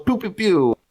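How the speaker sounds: high, mocking pew pew gun sounds; gun noises. Teasing a friend about Fox, mock gunfire noises. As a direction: spit out cartoon laser shots. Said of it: "He goes".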